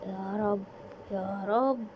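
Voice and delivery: normal talking voice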